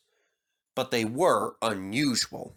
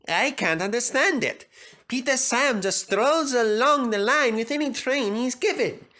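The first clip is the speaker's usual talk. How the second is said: Sir Handel impressed; 10 semitones higher than usual. A narrator's reading.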